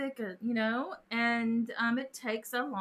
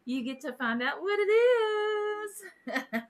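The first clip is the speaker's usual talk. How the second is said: higher, drawn-out reveal; teasing suspense; 7 semitones higher, slower than usual. Real, not acted. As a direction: dangle the surprise, stretching the last word. Teases the mystery prize.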